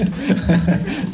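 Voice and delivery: high amused laugh; real laughter after a teasing aside. Real, not acted. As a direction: burst into a high chuckle. Amused aside about a listener.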